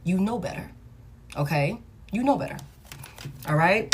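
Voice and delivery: low, hushed, measured counsel; calm reassurance. Real, not acted. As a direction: drop low and soft to reassure her.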